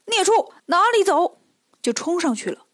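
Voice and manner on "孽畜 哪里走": high, loud battle cry. A narrator's reading. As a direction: charge in yelling at the beast. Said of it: "大喊一声". (高声大喝)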